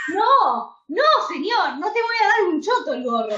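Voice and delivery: high, loud, outraged refusal; mock indignant yell. Friend shouts back at the ex over the hat, mock fury. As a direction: bark it out like a furious ex.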